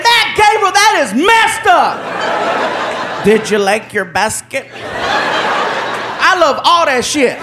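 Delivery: slow, high, amused protest; drawn-out laughing complaint. Acted. Neighbor laughing at the prank, slow amused protest. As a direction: laugh through it slowly.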